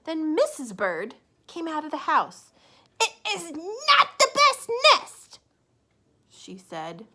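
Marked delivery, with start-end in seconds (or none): 0.0-2.5 bright, loud read-aloud voice; storybook reading. A narrator's reading. Teacher reading a picture book to children, lively and loud. 3.0-5.4 high, loud, slow laughing scoff; mock his nest with a laugh; Missus Bird scoffing. Missus Bird laughing off her husband's nest, high and loud.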